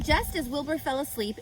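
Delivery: ordinary delivery, nothing marked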